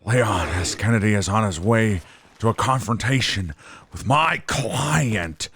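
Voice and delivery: raspy promo voice with drawn-out name; Paul Heyman. Acted. Hyping a client before a confrontation, raspy impression. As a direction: announce it raspy, stretching the last words. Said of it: "my Paul Heyman voice is too raspy".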